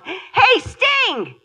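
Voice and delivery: high, loud call across the airport; yelled. Retold or imitated. Shouting to [name] across a crowded airport, loud. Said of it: "and I yelled".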